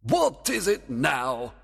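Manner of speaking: high, slow, exasperated question; irritated king; demanding. Acted. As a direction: demand an answer impatiently. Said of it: "demanded the king".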